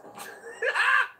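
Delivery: high shrill burst of laughter; shrieking laugh. Real, not acted. Laughing at imagined meltdown.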